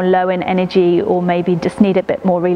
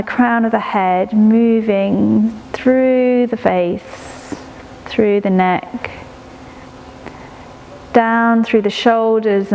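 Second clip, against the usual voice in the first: guided meditation. Acted. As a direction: ease the listener into stillness, breath by breath. Leads a prenatal body-scan meditation.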